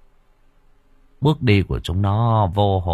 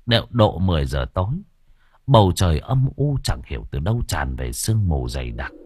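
plain reading voice

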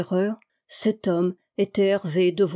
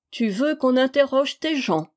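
higher-pitched mocking question; ironically incredulous, pitched up; ironic question; 5 semitones higher than usual. A narrator's reading. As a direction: ask it with lifted, feigned surprise. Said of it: "demanda ironiquement Toussaint".

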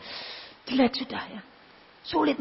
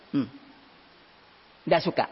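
normal talking voice

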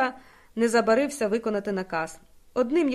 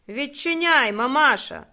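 loud, rude banging-on-the-door demand; 5 semitones higher, 5 dB louder, slower than usual. A narrator's reading. Soldier at the door demanding to be let in, loud and rude. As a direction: bark it at the woman inside. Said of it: "безцеремонно голосно поцікавився".

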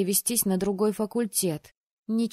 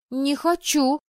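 higher, louder indignant no; indignant refusal; 7 semitones higher, 4 dB louder, slower than usual. A narrator's reading. Girl refusing the heroine's request, indignant. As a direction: refuse at once, offended. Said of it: "тут же возмутилась девушка".

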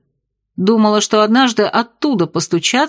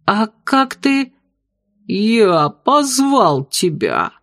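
gurgling tentacle alien; much slower than usual. Acted. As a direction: stretch it out, otherworldly. Octopus bartender speaks.

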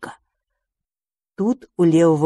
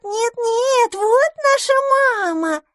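put-on squealing baby mermaids; slower than usual. Baby mermaids cling, crying.

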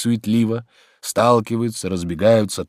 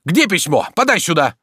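cross demand; 6 semitones higher than usual. A narrator's reading. Master crossly demands letter from servant.

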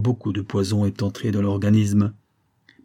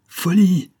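one high, loud word flung out; sharp exclamation; 9 semitones higher, 6 dB louder than usual. A narrator's reading. Lover rejects Edith's plan, sharp exclamation. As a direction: cut her off with it. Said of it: "fis-je".

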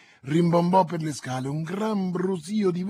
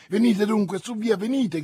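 higher and louder urgent call; shouting urgently; 6 semitones higher, 4 dB louder than usual. A narrator's reading. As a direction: shout to drag her along. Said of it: "gridava Milady". (grido concitato e incalzante)

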